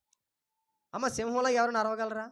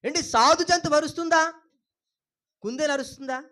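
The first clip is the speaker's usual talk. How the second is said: ordinary delivery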